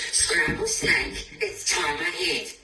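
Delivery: high, hissing, slow fright; scared snake whisper. Acted. Snake hearing it is food, hissing in fright.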